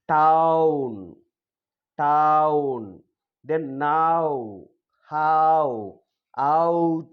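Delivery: "Town", "now", "how", "out" with a long falling ow glide; model pronunciation. Shown as a demonstration. Teacher drilling a diphthong, each ow word drawn out. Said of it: "like that we have to pronounce".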